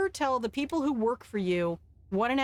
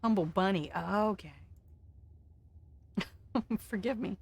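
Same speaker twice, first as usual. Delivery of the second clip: ordinary talk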